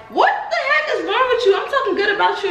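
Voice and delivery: high, laughing mock scolding; playfully scolding the puppy through laughter. Real, not acted. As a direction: tease the dog for misbehaving.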